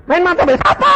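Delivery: high, loud feigned indignation; indignant retort. Retold or imitated. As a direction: fake outrage at the accusation. Husband defensive retort to wife.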